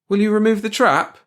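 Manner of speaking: high, loud mouse voice; insistent question. A narrator's reading. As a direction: press for action loudly. Mouse presses security officer.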